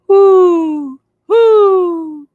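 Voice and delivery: high, drawn-out hooting owl; spooky owl calling in the night. Acted. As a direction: hoot like an owl in the dark.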